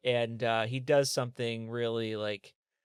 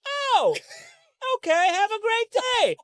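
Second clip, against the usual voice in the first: much higher, louder overjoyed yell; mock cheerful shout; 8 dB louder than usual. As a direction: shout it like a cartoon bystander. Victim cheerfully forgives.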